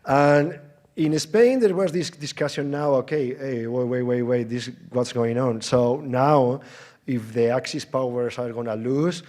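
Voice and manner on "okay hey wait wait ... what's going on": normal talking voice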